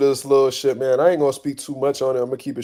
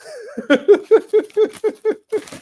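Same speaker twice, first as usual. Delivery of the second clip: high cackling laugh; real high laughing fit; burst of laughter; 4 dB louder than usual. Real, not acted. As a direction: crack up at the joke. Laughing at his own retelling.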